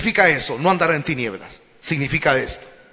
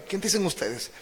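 soft echo; 11 dB quieter than usual. Acted. Quiet echo of the question.

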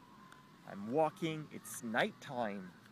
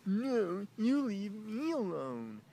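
high, loud mocking imitation; drawn-out, high-pitched mock retort; mimicking her; 9 semitones higher, 5 dB louder, slower than usual. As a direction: throw it back in her exaggerated high voice. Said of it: "She's like".

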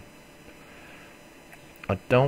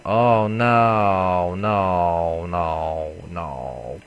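dismay fading; 5 dB louder, much slower than usual. Real, not acted. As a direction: despair that drains away. Giant dragonfly attack.

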